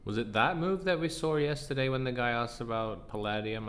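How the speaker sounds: usual speaking voice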